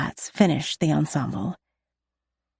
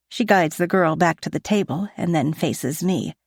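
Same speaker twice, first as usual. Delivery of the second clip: ordinary delivery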